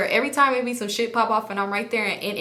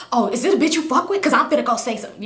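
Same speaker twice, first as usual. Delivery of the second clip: hot, confrontational; 3 semitones higher, 3 dB louder, faster than usual. Retold or imitated. Jealous woman challenging him about another girl, heated. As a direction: fire it off like you are ready to confront her. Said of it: "I'm hot right now".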